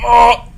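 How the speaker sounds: short high "oh" of fright; startled yelp. Real, not acted. Player turns round in the game, startled yelp. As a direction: yelp at a sudden scare. Jump scare on turning round.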